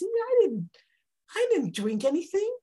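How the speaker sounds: high, insistent protest; protesting Hannah. Acted. Hannah tells Eli she drank nothing, protesting. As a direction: insist you're innocent. Hannah denies being drunk.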